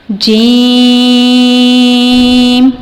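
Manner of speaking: جیم with the vowel held very long, from mid-tongue; drawn-out letter demo. Shown as a demonstration. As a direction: stretch جیم far out. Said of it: "اس کی حرکت ہم نے اسی طرح لمبی کرنی ہے". (جیم لمبا کھینچ کر)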